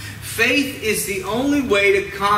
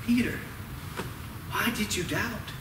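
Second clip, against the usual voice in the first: soft, hushed question with pauses; quietly voiced retold reproach; hushed gentle reproach; 11 dB quieter than usual. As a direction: ask it very quietly, as a tender rebuke. Jesus gently questions sinking Peter.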